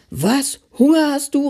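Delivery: high-pitched, alarmed question; startled exclamation. A narrator's reading. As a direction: call out as if taken aback. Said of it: "rief Opa Paul erschrocken".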